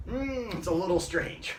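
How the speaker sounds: ordinary talk, nothing marked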